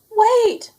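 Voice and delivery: high, loud cry. A narrator's reading. Sheep crying out as the tractor leaves, high and loud. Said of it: "cried Sheep".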